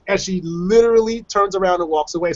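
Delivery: ordinary talk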